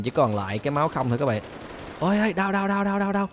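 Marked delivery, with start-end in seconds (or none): 2.0-3.3 high, rapid "đau" cries; pained yelps. Real, not acted. Hit by police bullets, high mock-pained yelps. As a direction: yelp like every shot stings.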